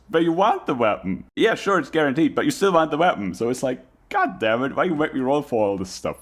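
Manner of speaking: ordinary talk, nothing marked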